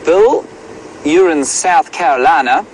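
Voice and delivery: Southern US accent. Shown as a demonstration. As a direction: call out in a badly faked Southern accent. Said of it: "Please excuse my southern accent".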